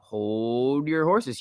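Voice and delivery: slow, stretched hold your horses; slowly savoured warning; drawn-out catchphrase. Acted. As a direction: drag out horses.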